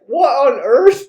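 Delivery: high, loud bewilderment; hammed-up astonishment; incredulous reaction. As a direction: exclaim it in comic disbelief. Teller's stunned reaction to the book.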